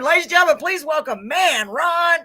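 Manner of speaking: loud, high emcee introduction; stage announcer. Pretend. Host pretending to bring Ron on stage, announcer voice.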